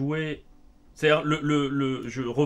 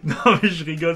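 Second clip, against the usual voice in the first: explaining through a laugh, louder; 3 semitones higher, 8 dB louder than usual. Real, not acted. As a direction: start talking while still chuckling. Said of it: "Pourquoi tu rigoles?".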